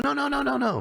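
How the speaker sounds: high-pitched, hurried string of no's; friend's quick denial. Retold or imitated. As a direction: wave it off with a high voice.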